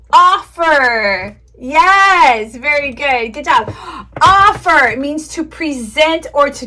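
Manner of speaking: bright, high, loud kindergarten teacher voice; teacher to young kids. Shown as a demonstration. Teaching demo to imaginary class.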